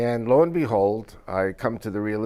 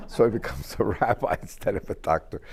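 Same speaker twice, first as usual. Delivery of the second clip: laughing; 3 dB quieter than usual. Real, not acted. Laughing at his own joke.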